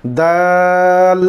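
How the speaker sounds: very slow, stretched আইন; drawn-out letter name. Shown as a demonstration. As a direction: hold আইন long as a model for pupils. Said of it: "teacher drawing out letter name".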